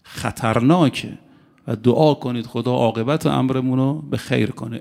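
normal talking voice

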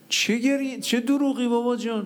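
high-pitched retold disbelief; incredulous retort. Son questions his weeping father over an old lie, incredulous retort. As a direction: question him in high, exasperated amazement.

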